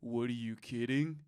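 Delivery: low, lifeless line reading; badly underplayed disbelief; mock bland acting. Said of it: "there is some bland acting".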